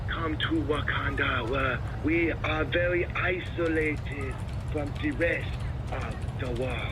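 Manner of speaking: slow put-on African accent; a slow, grand Wakandan welcome; slow mock Wakandan speech. Shown as a demonstration. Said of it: "Wakanda would be like".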